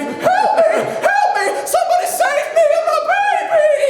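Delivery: hammed-up falsetto cry for help; shouting maiden. Maiden on a burning tower begging for rescue, high shouting. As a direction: shriek it as a mock damsel in distress. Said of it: "And she was shouting".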